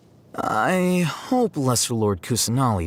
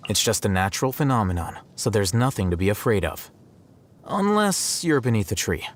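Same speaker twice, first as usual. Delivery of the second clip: ordinary talk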